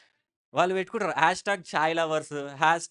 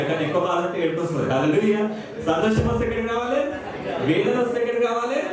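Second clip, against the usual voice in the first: higher, louder fervent preaching; preaching at full force; 4 semitones higher, 5 dB louder than usual. Acted. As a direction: drive it home to the congregation. (ఉత్సాహంగా బోధించే స్వరం)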